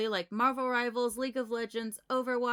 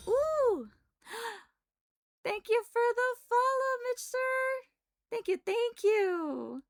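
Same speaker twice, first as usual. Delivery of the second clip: high squealing thanks; squealing excited; 13 semitones higher, 5 dB louder than usual. Real, not acted.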